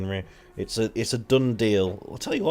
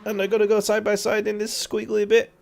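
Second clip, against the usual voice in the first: excited commentary climbing higher and louder; live race play-by-play; 11 semitones higher, 6 dB louder than usual. Real, not acted. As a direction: build up the excitement, high and quick.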